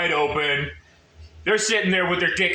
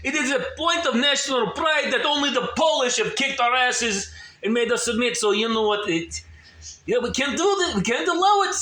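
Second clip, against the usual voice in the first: high, forceful Russian patriot voice; Russian accent; 5 semitones higher than usual. Acted. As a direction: declare it with Russian pride. Voicing a proud Russian patriot.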